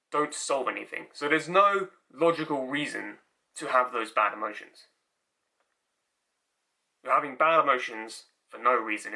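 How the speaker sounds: ordinary delivery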